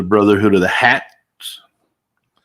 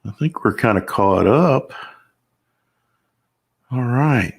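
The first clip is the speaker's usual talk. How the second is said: normal talking voice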